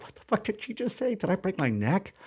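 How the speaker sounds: stunned disbelief. Retold or imitated. Husband and wife reeling at the diagnosis, high and hushed. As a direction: gasp out the question in shock. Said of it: "'cause it was this nervous laughter".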